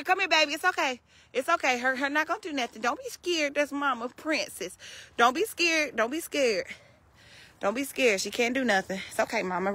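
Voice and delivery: soft, gentle reassurance; calm the scared pig down softly; hushed comforting voice; soothing. Real, not acted.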